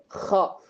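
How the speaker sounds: ஹா as a rasped kh, like hawking up spit; throat-clearing sound. Shown as a demonstration. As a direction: scrape the upper throat on ஹா, as if clearing it. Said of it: "நம்ம காரி துப்போம்".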